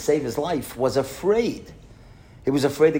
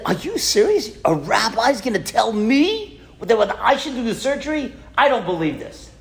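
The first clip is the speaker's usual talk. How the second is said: incredulous doctor; 7 semitones higher than usual. Retold or imitated. Doctor doubts a rabbi deciding.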